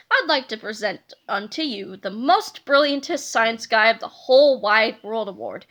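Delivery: put-on a kid faking a grown-up. Harold in disguise addressing Mr. Sneedley, fake grown-up voice. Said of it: "trying very hard to sound like a grown-up".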